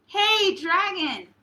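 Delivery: very loud, high yell; the princess yells into the sleeping dragon's ear; shouted as loud as she could. Acted. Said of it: "She shouted as loud as she could".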